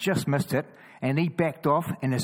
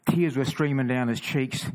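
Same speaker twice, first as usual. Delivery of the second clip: usual speaking voice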